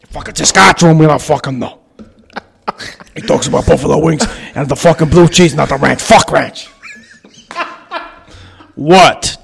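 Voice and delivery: Joe Rogan impression. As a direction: hype up the podcast like Rogan. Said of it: "the new one is Joe Rogan".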